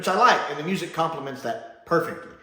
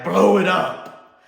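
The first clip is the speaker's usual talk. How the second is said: loud, drawn-out higher-pitched order; mock command; 6 semitones higher, 10 dB louder, much slower than usual. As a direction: give an absurd order at mock full volume. Mocks the absurd self-destruct instruction.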